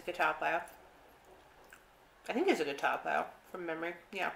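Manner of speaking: chewing between words; speaking with food in mouth. Real, not acted. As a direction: mouth partly full. Seen 2: lips pressed, chewing face between words, fork with food.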